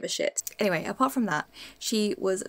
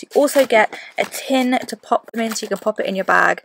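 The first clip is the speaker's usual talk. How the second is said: louder, punchy sponsor pitch; upbeat ad read; 7 dB louder than usual. Acted. Vlogger pitching sponsor tampons to viewers, loud and bright. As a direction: sell the product to camera with energy.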